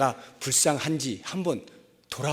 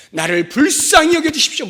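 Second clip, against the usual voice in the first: loud, high-pitched cry for mercy; cried-out plea; 12 semitones higher, 12 dB louder than usual. Acted. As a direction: cry out louder to be heard. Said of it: "더 소리를 지르게 되어 있는". (소리 높여 부르짖는 간구)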